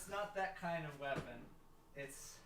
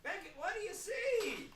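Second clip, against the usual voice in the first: put-on old man voice; 6 dB louder than usual. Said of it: "slip in my old man voice".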